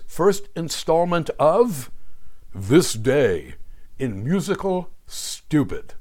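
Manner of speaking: big segment-title announcement; announcer voice. Acted.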